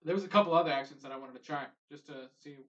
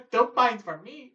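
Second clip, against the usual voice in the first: put-on Irish accent; 6 semitones higher, 5 dB louder, slower than usual. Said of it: "mock Irish pub talk".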